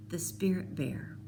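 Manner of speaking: awed whisper. A narrator's reading. Narrator seeing the spirit bear, whispered in awe. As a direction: breathe it in wonder.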